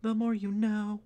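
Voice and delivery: high, loud TV announcer. Pretend. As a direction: belt it like a cheesy PSA tagline. Parody PSA jingle tagline.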